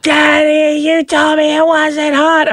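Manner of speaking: put-on high whiny child; upset kid voice. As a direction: complain like a burned kid. Son upset after hot pepper.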